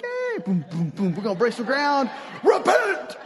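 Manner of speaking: high, whooping put-on cheer that builds to a yell; hammed-up party shouter; mock party hype. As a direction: hype the crowd, then blast out the last word. Mock pre-party hype clashing with message.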